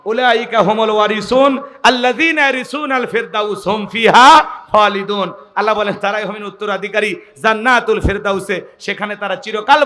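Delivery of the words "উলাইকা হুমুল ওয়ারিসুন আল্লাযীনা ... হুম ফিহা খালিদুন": quranic recitation. Acted. Quran verse recited in sermon.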